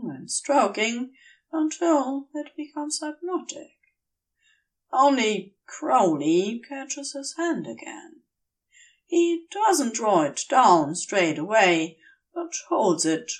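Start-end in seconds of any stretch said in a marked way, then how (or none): none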